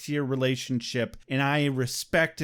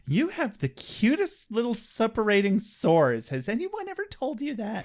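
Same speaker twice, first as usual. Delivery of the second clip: cooing mock flattery; 7 semitones higher than usual. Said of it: "and says".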